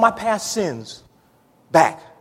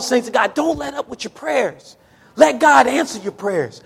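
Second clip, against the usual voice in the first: ordinary talk, nothing marked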